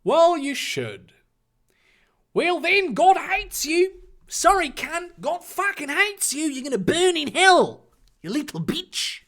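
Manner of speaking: high, put-on ranting voice; high-pitched comic damnation; Ron's character voice. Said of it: "Ron stated simply".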